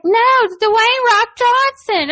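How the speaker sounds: put-on high insistent kid voice; high, firm little-boy correction; imitating a little kid. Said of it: "he's like".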